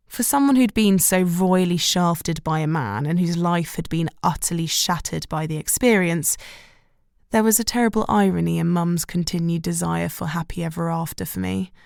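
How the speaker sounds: ordinary delivery, nothing marked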